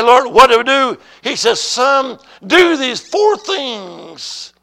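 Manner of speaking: loud, high preaching cry; shouted divine dialogue. Retold or imitated. Preacher asks Lord, gets four things, shouted. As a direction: shout it, stretching the last words slowly.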